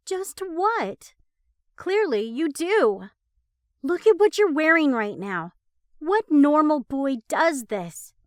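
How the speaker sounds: loud high contempt; mock him harshly; angry scorn. A narrator's reading. Becca mocking him angrily.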